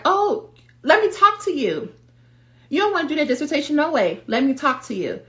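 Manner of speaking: high-pitched, insistent self-doubt; acted nag seizing the chance to talk; pouncing inner voice. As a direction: butt in eagerly, pitched well up. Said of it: "Now that voice is like".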